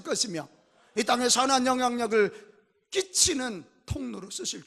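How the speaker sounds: loud, rousing sermon proclamation; full-force preaching. Real, not acted. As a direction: declare the promise forcefully to the congregation.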